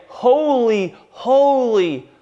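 drawn-out angelic acclamation, easing at the end; crying out. Retold or imitated. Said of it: "they cry out and they say".

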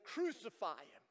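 ordinary talk, nothing marked

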